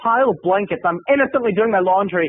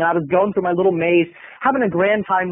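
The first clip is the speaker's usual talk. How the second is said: ordinary talk, nothing marked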